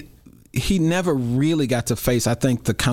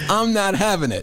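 slightly raised, amused quote; mock quote; 10 semitones higher than usual. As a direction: echo her line with a laugh. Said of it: "a mayor of Chicago say".

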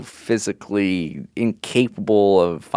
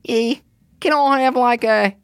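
bad Scottish accent; 13 semitones higher, slower than usual. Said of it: "That's definitely not a Scottish accent".

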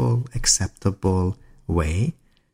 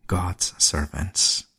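normal talking voice

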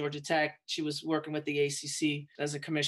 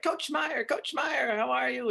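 high, bright-eyed mock hello over the phone; imitating a friend's gushing phone hello; excited greeting; 8 semitones higher, slower than usual. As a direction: greet an old friend with a burst of delight, pitch way up.

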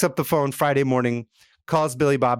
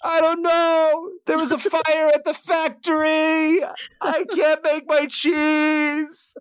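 much higher and slow, a hammed-up weepy wail; crying cheesemonger; much slower than usual. Billy Bob tells Ray the factory burned, slow high mock crying. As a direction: stretch each word out like a man in tears, pitched way up. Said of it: "Billy Bob cries on the phone".

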